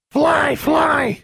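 high shrieking bird voice; raven cry. A narrator's reading. The raven Charlie squawks at Alexandra, high and shrill. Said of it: "said Charlie".

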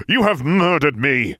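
slow, high theatrical accusation; drawn-out, hammy outrage; melodramatic dying cry. Acted. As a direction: declaim it like a villain dying on the floor.

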